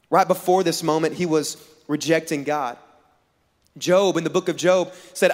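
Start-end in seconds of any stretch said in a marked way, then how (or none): none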